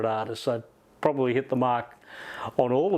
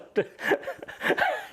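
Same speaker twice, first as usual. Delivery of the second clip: high breathy laugh at the memory; a burst of airy laughter. Real, not acted. Interviewer laughing while retelling the Harrison call. Laughing at his own impression.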